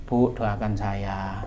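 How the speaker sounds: soft, slow, low plea; humble request. Acted. Child asking mother for prayers, soft humble request.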